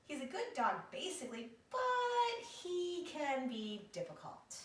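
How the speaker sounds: lightly voiced fretful owner, rising high and slowing at the end; a hint of a worried dog owner, drawn out on difficult; owner's quote. Owner tells the reporter about her dog, a touch weary and high. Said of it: "Missus LaRue said".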